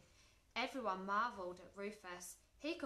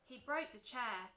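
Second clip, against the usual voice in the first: usual speaking voice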